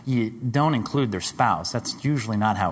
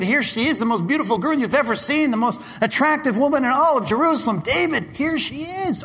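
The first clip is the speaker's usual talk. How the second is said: high, loud tempter voice offering the prize; tempting Satan; 13 semitones higher, 3 dB louder than usual. Acted. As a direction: dangle her before David like bait. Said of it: "Satan saying to David".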